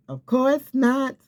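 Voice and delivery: high, slow, emphatic scoff; of course not. Acted. Preacher dismissing a stranger's false word, high slow scoff.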